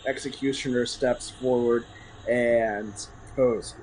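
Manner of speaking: normal talking voice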